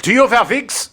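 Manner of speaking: high, put-on German reporter accent; imitating a German reporter's clumsy question; mock German reporter. Friend retells Dominic's German reporter bit. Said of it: "pretends to be a German reporter".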